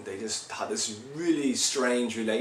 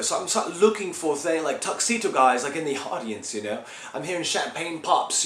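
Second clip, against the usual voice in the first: higher, louder, worked-up retelling; agitated paranoia; 6 semitones higher, 4 dB louder than usual. Acted. Rock singer recounting stalker letters, agitated and paranoid. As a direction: tell it keyed up, pitch raised, piling up the threats.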